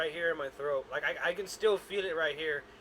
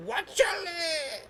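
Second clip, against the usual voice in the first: George Lopez shout; 12 semitones higher, much slower than usual. Pretend. Dog voiced as George Lopez.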